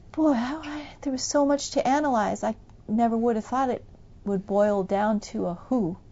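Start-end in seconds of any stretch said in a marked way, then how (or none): none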